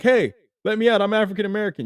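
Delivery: loud, high plea to be let out; hammed-up arrested traveler yelling high; mock desperate plea. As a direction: shout through the bars, begging the guard. You're not gonna be like.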